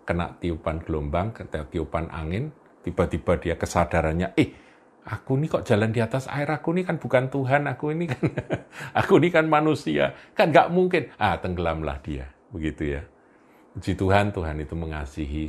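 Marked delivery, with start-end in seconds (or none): 4.4-11.1 high, fast, rising alarm; sudden panic. Acted. Peter sinking realizes he is only human, panicking. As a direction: realize you are sinking mid-step.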